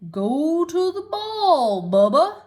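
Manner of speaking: slow, drawn-out voice from nowhere; mysterious voice. A narrator's reading. Voice in the pasture calling Bubba, slow and mysterious. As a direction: sound like a voice out of the air. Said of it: "said the voice".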